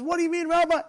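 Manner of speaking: high, loud acted protest; indignant protest. Rejected man challenges the rabbi, indignant. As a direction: protest loudly at the top of the voice. Told he cannot go up.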